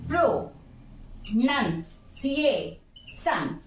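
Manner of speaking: प्रो, नन, सी ए, सन with a pause after each; teacher sounding out प्रो, नन, सी ए, सन; syllable by syllable. Shown as a demonstration. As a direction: break it into separate pieces: प्रो, नन, सी ए, सन. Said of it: "word को syllables".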